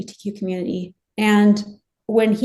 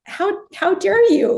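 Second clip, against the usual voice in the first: raised, indignant outburst, voice climbing higher; livid; 6 semitones higher, 5 dB louder than usual. Retold or imitated. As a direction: fire it back in outrage, high and loud. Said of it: "I was just livid".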